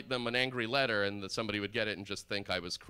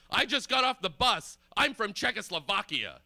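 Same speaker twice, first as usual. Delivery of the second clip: very high, loud, indignant shouting; even madder mock yell; 11 semitones higher, 6 dB louder than usual. Stranger shouting after a man leaving, mock furious. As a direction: imitate a furious stranger at full pitch. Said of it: "he gets even more mad".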